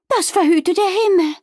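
high, loud dismay; horrified exclamation. Acted. Wilma hears about the high cliff, horrified. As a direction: exclaim in sheer alarm.

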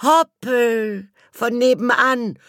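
put-on very high, slow girl's voice in dismay; squeaky girl Elli, aghast; girl crying out. A narrator's reading. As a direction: play Elli's high-pitched dismay. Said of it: "schrie Elli".

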